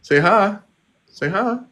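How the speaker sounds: high sing-song coaxing. Real, not acted. Owner urging parakeet to say hi, high coaxing. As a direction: coax the bird in a high voice.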